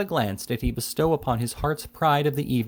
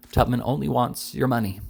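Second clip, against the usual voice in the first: ordinary delivery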